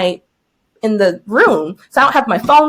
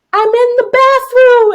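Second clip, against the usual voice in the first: retold yell through wall; 12 semitones higher, 5 dB louder than usual. Teacher yelling through a brick wall, mock desperate shout. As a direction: shout it to people beyond a wall. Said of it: "I put my mouth to the wall".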